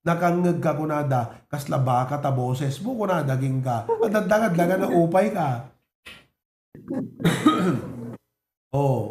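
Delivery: ordinary talk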